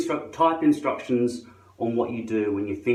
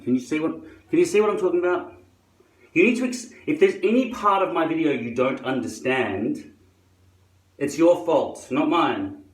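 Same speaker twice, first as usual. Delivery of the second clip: raised, higher-pitched exasperated scolding; exasperated rant; 6 semitones higher, 4 dB louder than usual. Acted. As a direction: tell off the viewers, voice jumping up and louder. Mock rant at confused viewers.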